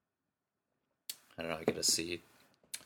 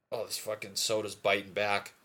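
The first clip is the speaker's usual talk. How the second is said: ordinary talk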